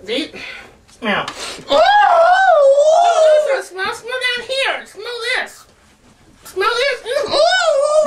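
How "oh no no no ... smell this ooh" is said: much higher, louder squealing; disgusted shrieks. Real, not acted. Reacting to the stinky smell, laughing high shrieks.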